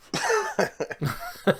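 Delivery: short high laugh; brief high-pitched burst of laughter. Real, not acted. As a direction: let out a quick high laugh. Airport story gets a laugh.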